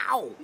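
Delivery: put-on high accented yelp of pain; Tom Dustin. Friend hurt in the pool, imitated accented yelp. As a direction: mimic a pained "ow" in an accent. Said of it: "pain in an accent".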